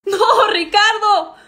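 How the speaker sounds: loud, slow hammed-up wail; mock anguished cry. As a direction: wail it like a melodrama. Desperate cry during chaos. (lamento exagerado y fuerte)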